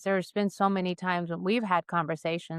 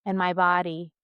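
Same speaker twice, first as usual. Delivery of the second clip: closing prayer. Acted. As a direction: let each phrase land with long pauses. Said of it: "now I'm going to do a little closing prayer".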